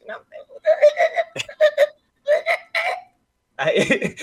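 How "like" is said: high-pitched excited voice. Shown as a demonstration. Streamer showing his friend how his voice jumps when excited. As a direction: voice leaps way higher, laughing. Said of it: "my voice get high pitched".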